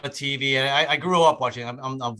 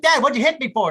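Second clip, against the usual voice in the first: put-on indignant kid yell; 10 semitones higher, 6 dB louder than usual.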